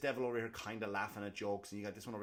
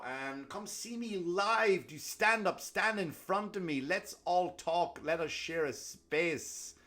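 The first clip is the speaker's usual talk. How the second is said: mock solemn; 7 semitones higher, 5 dB louder, slower than usual.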